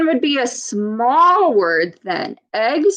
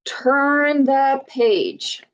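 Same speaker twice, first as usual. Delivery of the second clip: a slow, teacherly turn the page; slow, drawn out; 3 semitones higher, much slower than usual. Shown as a demonstration. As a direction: turn the page stretched out slowly. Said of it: "What should we do to find out".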